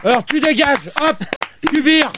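high-pitched irate order; angry dismissal. Real, not acted. Host orders staffer out of the show, angry dismissal. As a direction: throw her out on the spot.

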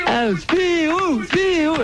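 high, stretched-out name call; long, high call down the line. Real, not acted. Calling unresponsive caller by name.

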